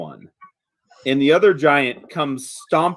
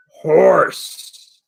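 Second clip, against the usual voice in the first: giantess saying horse; 3 semitones higher, 5 dB louder than usual. Acted. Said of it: "she says".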